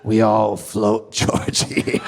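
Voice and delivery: low, slow sinister clown voice; Pennywise impression. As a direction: drawl it out like the clown in the drain. Quoting Pennywise from It.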